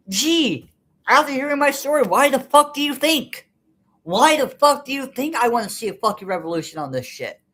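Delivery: angry outburst. Real, not acted. Ranting to viewers about why they want revolution, furious and loud. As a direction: let the anger boil over into the question. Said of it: "and I'm angry".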